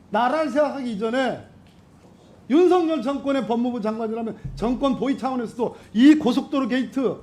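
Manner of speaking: high-pitched, impassioned accusation; indignant interrogation. Real, not acted. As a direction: press the minister hard, pitch held high. Heated political interrogation of minister.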